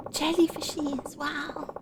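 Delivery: high, slow, hushed wonder; soft delight. Real, not acted. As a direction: coo at the jellyfish. Aside about jellyfish.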